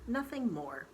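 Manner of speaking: usual speaking voice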